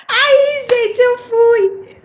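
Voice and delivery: high, loud words squeezed out through laughter; laugh-crying. Real, not acted. Telling her followers while laughing to tears.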